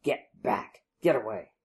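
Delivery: low, whispery snarl; hushed, menacing warning; snarl under breath. A narrator's reading. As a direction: snarl it softly, almost without voice. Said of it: "snarled under his breath".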